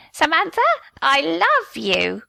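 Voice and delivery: a hint of a parrot calling out Samantha; parrot squawk. Lightly voiced parrot repeating a taught love call. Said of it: "taught those damn parrots to shout".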